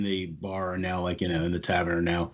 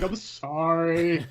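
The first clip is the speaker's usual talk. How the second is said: high, slow, tearful sorry; sobbing apology; 8 semitones higher, much slower than usual. Acted. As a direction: whimper the apology. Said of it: "He's like".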